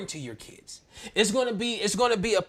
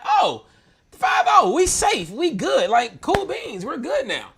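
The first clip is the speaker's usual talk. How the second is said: much higher and louder, gleeful cheer at the cops arriving; excited relief; 6 semitones higher, 9 dB louder, slower than usual. Retold or imitated. Telling how he cheered when police pulled up, loud high excitement. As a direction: whoop it up like the cavalry just came.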